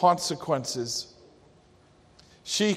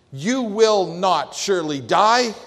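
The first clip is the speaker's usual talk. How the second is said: louder, higher, stressing every word of "You will not surely die"; emphatic denial; 4 semitones higher, 5 dB louder than usual. Shown as a demonstration. Preacher hammering home the serpent's emphatic lie to the listeners. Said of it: "extremely emphatic statement".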